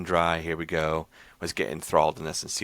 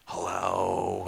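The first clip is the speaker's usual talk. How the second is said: put-on creepy girl voice; 4 dB quieter than usual. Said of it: "like, a creepy girl voice".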